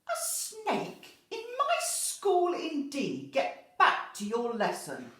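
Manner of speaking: loud, high, drawn-out outrage; storybook telling-off at full volume; indignant headmistress. Acted. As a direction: scold slowly, then order them off.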